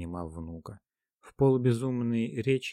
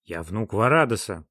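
louder, proud cry; proud exclamation; 3 semitones higher, 10 dB louder than usual. A narrator's reading. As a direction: declare it proudly. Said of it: "гордо воскликнул молодой человек".

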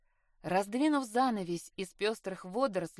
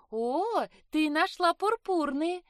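high, louder burst of delight; delighted surprise; 9 semitones higher, 5 dB louder, slower than usual. Acted. Sister spotting purple beads in the bag, delighted. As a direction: exclaim happily, pitch jumping up.

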